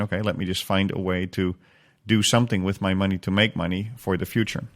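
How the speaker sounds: usual speaking voice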